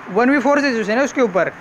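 normal talking voice

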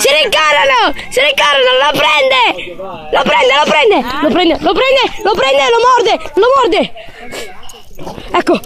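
excited yelling, phrases fired off repeatedly; shouted commentary. Pretend. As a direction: shout the chase like a sportscaster. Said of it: "Non serve gridare". (telecronaca gridata per gioco)

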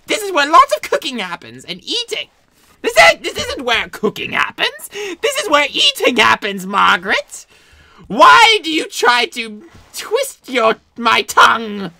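high, loud, irritated scolding; a touch of anger. Acted. Doctor scolds Margaret for twisting his words, high and loud. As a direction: snap at the assistant.

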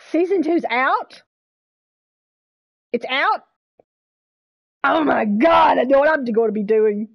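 loud, thrilled outburst; loud fangirl excitement; real excited shout. Real, not acted. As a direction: shout it out, overjoyed at the news. Learns season two is out.

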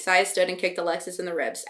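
plain reading voice

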